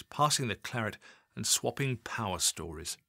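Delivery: usual speaking voice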